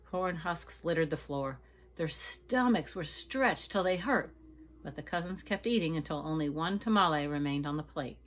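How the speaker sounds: usual speaking voice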